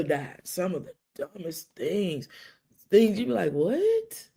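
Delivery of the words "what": sharp high disbelieving what; incredulous what. Retold or imitated. Hearing a dumb condolence, an incredulous what. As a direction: spit out the disbelief.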